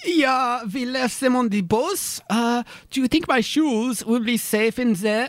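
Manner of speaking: put-on thick German tourist accent. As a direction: play a blunt German nudist. Tourist voice again.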